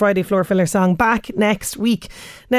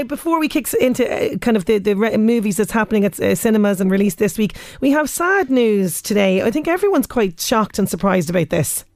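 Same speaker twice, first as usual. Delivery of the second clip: usual speaking voice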